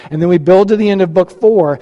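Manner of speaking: ordinary talk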